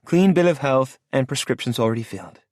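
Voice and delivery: lightly voiced woman listing facts; Lola's voice. A narrator's reading.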